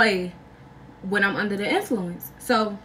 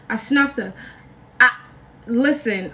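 ordinary talk

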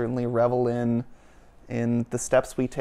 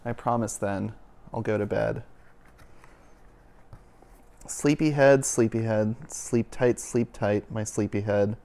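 bedtime story reading. Acted. Reading a bedtime story to the children, gently. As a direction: read it like a lullaby to a sleepy child.

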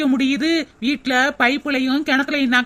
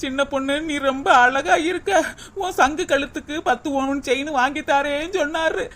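mock lament; 3 semitones lower than usual. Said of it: "ஏன்டி இப்படி புலம்பிக்கிட்டு கிடக்க". (கேலியான புலம்பல்)

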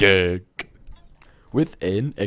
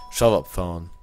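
normal talking voice

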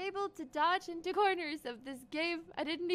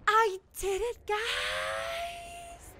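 raised, drawn-out wail of victory; triumphant cry; 9 semitones higher, much slower than usual. Real, not acted. Celebrates success in the game.

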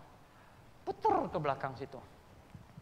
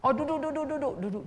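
eager welcome; 11 semitones higher, 5 dB louder, faster than usual. Retold or imitated. Excited invitation to sit.

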